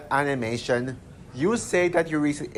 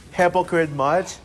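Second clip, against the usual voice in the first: sarcastic jab; 2 semitones higher than usual. Real, not acted.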